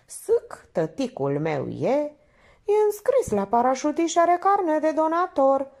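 high singsong playground taunt; simpering childish boast. A narrator's reading. Minerva showing off like a child, simpering. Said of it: "Minerva găsi prilejul să se sclifosească".

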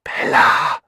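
loud, drawn-out stage whisper; exaggerated whisper. A narrator's reading. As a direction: hiss the name in an overdone whisper. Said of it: "flüsterte sie übertrieben". (übertrieben lautes Flüstern)

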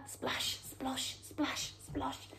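soft breathy sound words for wading; whispered splashes. Acted. Reading to children, whispered water sounds. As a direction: hush the splash and splosh. River on the way back.

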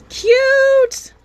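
high loud squeal; overwhelmed by cuteness. Real, not acted. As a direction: squeal one word. Squeal at narwhal.

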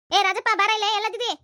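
loud, high summoning shout; angry calling. Acted. Grandmother yelling for Rajappa to come, angry shout. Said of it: "ಅಜ್ಜಿ ಕೂಗಾಕತ್ತಿದ್ ನೋಡಿದ್ರ ಯಾಕ ಸಿಟ್ಟಿಲೆ ಕರ್ದಂಗ್".

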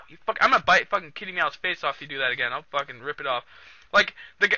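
usual speaking voice

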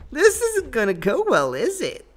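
comic high-pitched gamer despair voice; mock high voice. Mock voice after dying in fight.

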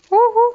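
high, loud double hoot of alarm; startled whoop. Real, not acted. Gamer caught off guard in play, startled whoop. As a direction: let out a sudden high yelp. Jump scare in the game.

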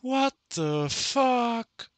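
high, loud, drawn-out shriek; shrieking jackpot winner. Real, not acted. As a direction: shriek it slowly in disbelief. Schock über Bienenschwarm. (schrilles, langgezogenes Kreischen)

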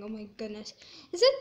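ordinary delivery

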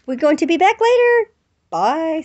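high, loud bright goodbye; upbeat outro to viewers; cheery sign-off. Real, not acted.

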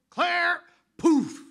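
very high, loud yelp of a name; startled outburst. Retold or imitated. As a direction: blurt it out from across the room. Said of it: "over in the corner I hear".

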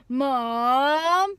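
loud drawn-out whine of Mom; whiny yelled Mom. Shown as a demonstration. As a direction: moan MOM loud and long.

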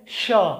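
श with the tongue touching the palate; palatal sh. Shown as a demonstration. Teacher demonstrating palatal श. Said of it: "थोड़ा सा जीभ को ऊपर तालू में सटाएंगे".

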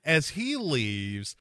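ordinary delivery

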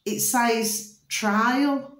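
"trial" stretched very slowly for emphasis; revealing "trial" in industrial, slowly; stress on trial. Shown as a demonstration. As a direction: draw out "trial" to reveal the hidden word. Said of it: "look at this a different way".

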